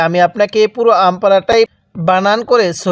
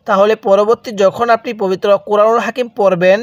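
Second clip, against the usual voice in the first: ordinary talk, nothing marked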